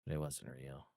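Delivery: quiet, low and despondent; mournful. Acted. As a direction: trail off into gloom. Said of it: "Droop goes".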